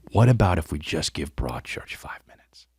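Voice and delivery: sneaky whispered suggestion. Retold or imitated. Pitching his show to wife on the sly, whispered. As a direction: whisper it like a scheme.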